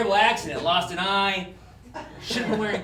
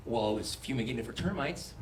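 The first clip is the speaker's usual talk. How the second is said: low, soft agent; 9 semitones lower, 9 dB quieter than usual. Acted. Agent answers.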